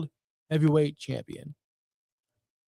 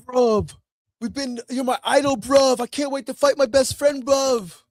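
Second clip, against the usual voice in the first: higher, louder mock British wrestler voice; mock British accent; 7 semitones higher, 3 dB louder than usual. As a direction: ham up a British lad gushing over his best friend. Mocking British wrestlers' Dynamite promos.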